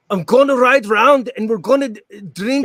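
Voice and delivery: high-pitched mock Scottish; amateur Scottish impression, pitched well up; Braveheart Scottish accent. Shown as a demonstration. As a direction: put on a hammed-up movie Scottish accent. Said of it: "I just do Braveheart".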